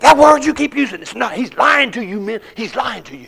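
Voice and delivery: loud, high angry yell; raging shout. Retold or imitated. Inmate rages at the preacher before the men. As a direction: rage at the men around the table. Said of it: "he's raging".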